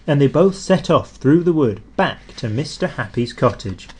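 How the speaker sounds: ordinary delivery, nothing marked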